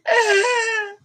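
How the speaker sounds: high, crying-out laugh; high laughing cackle. Real, not acted. At her own story about Mike's wife, a high cackling laugh. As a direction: cackle through tears of laughter.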